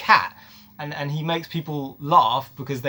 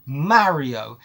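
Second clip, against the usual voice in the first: normal talking voice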